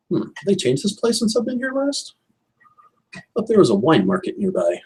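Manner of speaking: lightly voiced rich man, a high puzzled hmm up front; a hint of a lost gentleman musing aloud; Max in character. Max, lost in the fish market, muttering to himself, lightly voiced. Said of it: "Max will look around".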